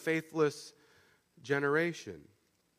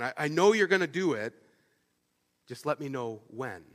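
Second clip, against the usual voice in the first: trusting plea; 3 semitones lower than usual. Retold or imitated. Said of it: "the same words with a very different tone".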